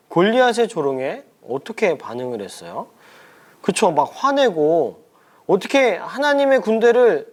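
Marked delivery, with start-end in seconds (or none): 5.5-7.3 acted indignation, pitched higher; 화내는 다윗. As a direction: challenge the mockers in storybook outrage. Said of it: "라면서 막 화를 냈던 것 같아요". (분개한 듯 높인 목소리)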